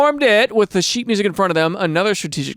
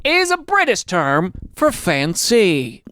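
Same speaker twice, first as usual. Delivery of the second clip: mock explainer; 4 semitones higher, much slower than usual. Slow aside explanation, different timbre.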